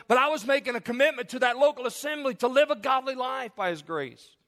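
high, driving pulpit delivery; impassioned preaching. Real, not acted. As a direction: press the point home without letting up. Preaches at full force.

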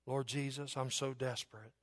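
soft, desperate prayer, held back; humble plea. Acted. Desperate woman basically saying to Christ, quiet humble plea. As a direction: plead quietly, surrendering to Jesus. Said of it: "she was basically saying to Christ".

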